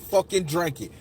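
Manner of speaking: ordinary talk, nothing marked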